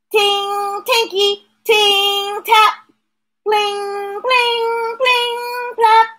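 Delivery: high sing-song; high sing-song sound words. Acted.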